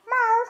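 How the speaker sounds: high squeaky meow; a little kitten's meow; high mewing cat. Shown as a demonstration. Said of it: "A pussycat".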